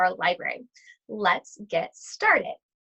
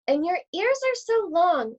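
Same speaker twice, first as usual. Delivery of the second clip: little Red wondering; 7 semitones higher than usual. Acted. Red questions her granny.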